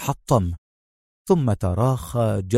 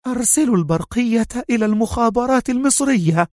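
choked voice; 11 semitones higher than usual. A narrator's reading. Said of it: "واختنق صوتها".

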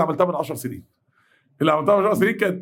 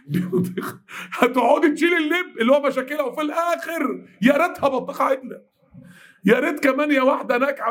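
exasperated high voice; 6 semitones higher than usual. Acted. Mocking a useless friend.

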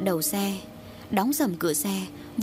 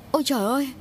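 mildly startled exclamation, pitched higher; startled cry; 4 semitones higher, much faster than usual. A narrator's reading. Kids glimpse someone in the hut, mildly startled. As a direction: cry out with a touch of shock. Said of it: "thảng thốt giật mình kêu lên".

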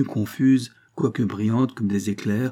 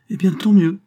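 louder, higher, delighted; gleeful girl's cry; 10 semitones higher, 8 dB louder than usual. A narrator's reading. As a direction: cheer his bad news playfully. Catherine laughs at his woe.

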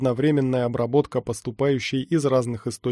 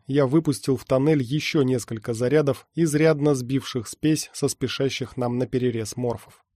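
plain reading voice

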